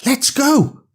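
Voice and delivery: put-on high, eager little girl's cheer; excitedly. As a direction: sing out ready to go. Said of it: "she said excitedly".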